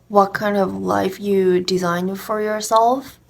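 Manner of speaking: ordinary talk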